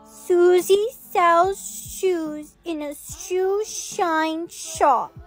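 halting, stretched-out tongue twister; slow, cautious child's recital. Acted. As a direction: say each word separately and carefully. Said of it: "you need to say it very slowly".

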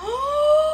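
sudden high gasp of delight; sharp gasp. Real, not acted. Opening a birthday gift from a friend, sharp gasp.

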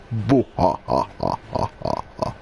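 deep booming mock laugh; Griffin laugh. Mimicking Griffin's laugh for the viewers, deep booming laugh. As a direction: boo ha ha ha ha, booming and low like Griffin. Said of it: "that's the Griffin laugh".

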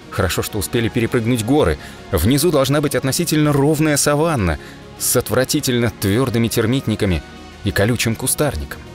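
usual speaking voice

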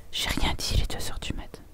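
hushed, voiceless whisper. Real, not acted. Streamer realising it is two in the morning, whispering. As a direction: whisper it as if people are asleep.

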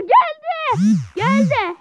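high, loud release; triumphant shout. Real, not acted. Gamer cheering the timer's end, triumphant. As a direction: shout it out as it arrives. Relief when timer ends.

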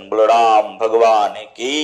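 slow, drawn-out call to the crowd; call for praise. Acted. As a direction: stretch it out, inviting the congregation to answer. Preacher calls for jai.

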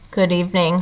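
low, soft mumble; mumbled. A narrator's reading. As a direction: mumble it under your breath. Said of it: "she mumbled".

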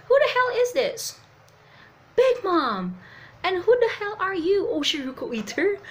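loud, high indignant demand; hammed-up outraged questioning; acted angry challenge. Voices angry confrontation of characters.

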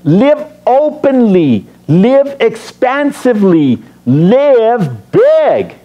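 high, drawn-out rallying call; slow, emphatic preacher's exhortation; encouragement. Acted. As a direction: urge them on, stretching each phrase. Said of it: "Notice the encouragement".

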